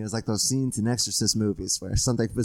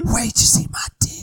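whisper; 8 semitones higher, 5 dB louder than usual. Acted. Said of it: "You just started whispering".

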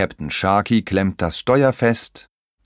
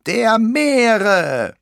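very high, loud drawn-out shout; high-pitched pirate war cry; pirate's bellow; 5 dB louder than usual. A narrator's reading. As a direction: bellow it out like a little pirate captain.

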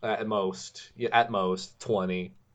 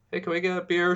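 mock extra asking; 8 semitones higher, faster than usual. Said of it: "extra asking for beer".

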